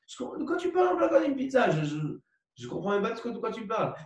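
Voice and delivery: incompréhension jouée. Acted. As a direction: squeak it out as if utterly baffled. Rejoue un interlocuteur totalement incrédule. (incompréhension feinte, d'une voix très aiguë)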